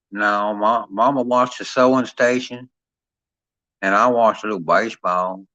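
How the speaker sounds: imitated Southern country chatter about sports. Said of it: "that's what you get down here".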